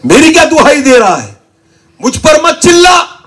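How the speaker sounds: scolding. Retold or imitated. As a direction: tell him off at full voice. God rebuking Moses.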